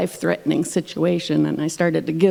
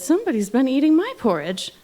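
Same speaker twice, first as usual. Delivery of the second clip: high-pitched storybook mother bear; Mama Bear's higher voice, stressing "my"; 7 semitones higher than usual. A narrator's reading. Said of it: "Mama Bear said".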